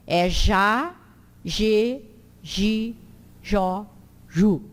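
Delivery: JÁ, GE, GI, JÓ, JU each on zh, separated; true J sound. Shown as a demonstration. Teacher lists accented J syllables, each with zh. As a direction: drill zh syllables one by one. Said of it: "tem o som do J mesmo".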